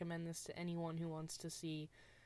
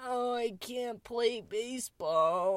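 slow, whiny Bella imitation, a bit louder; mocking Bella again; 3 semitones higher, 4 dB louder, slower than usual. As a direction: drag out each word like a helpless Bella. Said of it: "that's my Bella".

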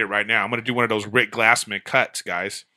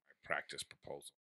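normal talking voice

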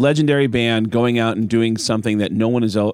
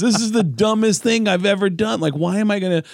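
mock panic; 10 semitones higher than usual. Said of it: "I'm like".

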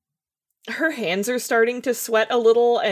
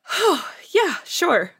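higher, bright little yes; light eager reply; 9 semitones higher, slower than usual. Acted. Stella relaxing, accepting Grayson's offer with a high eager yes. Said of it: "she goes".

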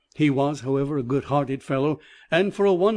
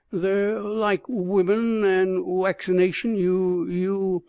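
slow, far-off dreamy murmur in a rustic dialect; a lightly voiced trance, slow and faraway; dreamily; 5 semitones higher, slower than usual. A narrator's reading. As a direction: drift off as if half asleep. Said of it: "and said dreamily".

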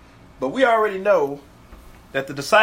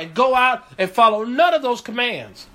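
ordinary talk

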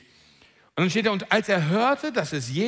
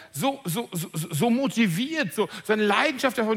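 usual speaking voice

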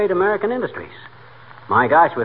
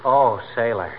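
ordinary delivery

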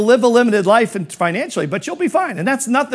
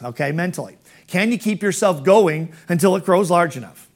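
ordinary talk, nothing marked

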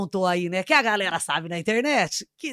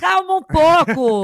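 mock scolding; 6 semitones higher, 10 dB louder, slower than usual. Mock-scolding the interrupting host.

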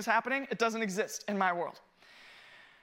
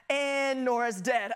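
mock dread; slower than usual. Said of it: "I immediately was like".

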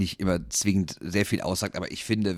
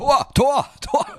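screaming goal commentary; 8 dB louder, slower than usual. Pretend. Goal called out in podcast.

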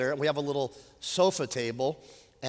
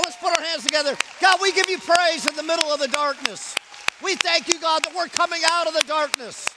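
raised, driving praise rally; fervent preaching; 11 semitones higher, 7 dB louder than usual. Real, not acted. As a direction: rouse the congregation to praise God. Rallies congregation to praise.

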